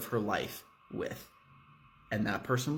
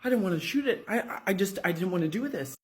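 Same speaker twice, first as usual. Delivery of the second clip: panicked cop; 6 semitones higher, faster than usual. Acted. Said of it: "Wells is just like".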